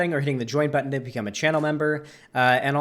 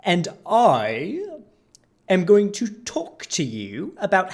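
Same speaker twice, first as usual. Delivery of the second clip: slow, pompous fake British accent, higher and louder; mock British accent; 5 semitones higher, 3 dB louder, much slower than usual. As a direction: lecture like a smug fake Brit. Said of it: "accent like that instead of his fucking bore".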